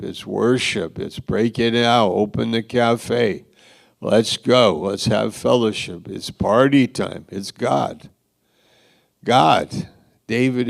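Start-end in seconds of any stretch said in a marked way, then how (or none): none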